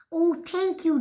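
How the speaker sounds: ordinary delivery, nothing marked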